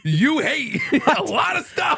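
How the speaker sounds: high, slowed-down exclamation with a laugh; exasperated teasing. Real, not acted. Co-host to friend who dislikes swimming, exasperated teasing. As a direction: drag out the high-pitched accusation, laughing.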